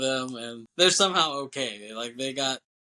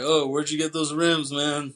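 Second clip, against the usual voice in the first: higher, louder mock-cool question; cheesy slang; 4 semitones higher, 5 dB louder than usual. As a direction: imitate someone trying too hard at slang. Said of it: "trying to talk, like, slang. It's just cheesy".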